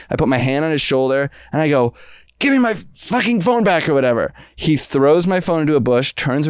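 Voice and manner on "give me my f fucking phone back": high, worked-up retold demand; strained, high-pitched outburst; mock angry demand. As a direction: re-enact confronting a thief. Re-enacts confronting the phone thief.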